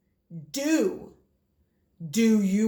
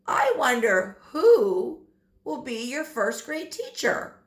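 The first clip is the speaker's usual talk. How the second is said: normal talking voice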